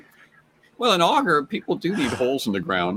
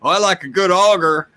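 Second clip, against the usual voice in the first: Southern auger fan; 3 semitones higher, 4 dB louder than usual. Retold or imitated. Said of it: "and he goes".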